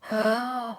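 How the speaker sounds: quiet sharp intake of breath; held-breath gasp. Acted. Boy hiding behind the sofa, breath-holding gasp. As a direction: suck in air and hold it. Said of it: "So I stop breathing".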